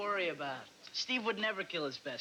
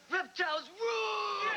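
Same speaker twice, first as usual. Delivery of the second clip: shouted cheer; 12 semitones higher than usual. Acted. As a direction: yell it like a fan chant. Cheering for the frog in class.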